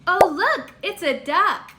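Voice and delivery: usual speaking voice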